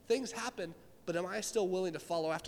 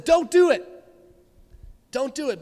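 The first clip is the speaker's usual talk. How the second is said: urgent plea; 7 semitones higher, 12 dB louder than usual. Acted. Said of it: "He stopped and said".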